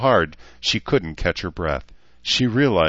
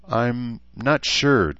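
slow, higher, unsure reply; hesitant; 6 semitones higher, 3 dB louder, much slower than usual. A narrator's reading. Human woman unsure how she feels about men, hesitant. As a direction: draw it out uncertainly.

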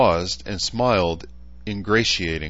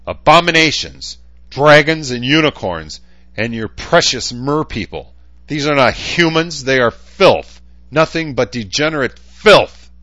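loud, much higher raging tirade; furious rant; 8 semitones higher, 9 dB louder than usual. A narrator's reading. Chansa raging at the changed, shouting. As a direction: spit out every insult. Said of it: "his patience apparently gone".

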